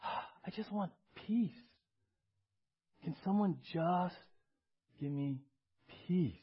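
sighing, slow plea broken by pauses; sigh, then let the words sink away; weary plea. Acted. Preacher voices someone worn out by bad news, weary plea.

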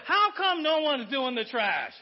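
loud, slow, exasperated whine about the trash; mock complaint. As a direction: ham up the complaining spouse. Pastor acts out frustrated spouse.

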